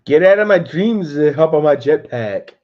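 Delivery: loud put-on voice quoting the movie; lightly voiced movie character doing the line loud; quoted movie line. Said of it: "why does it sound like I'm".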